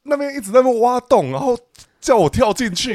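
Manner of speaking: high-pitched, heated pushback against being framed; indignant protest. Real, not acted.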